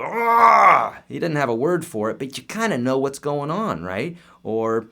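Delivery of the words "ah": sudden loud high yelp like a caveman who dropped a rock on his foot; caveman pain yell. Retold or imitated. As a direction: blurt out a raw, wordless cry of pain, much higher and louder. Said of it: "he's gonna go".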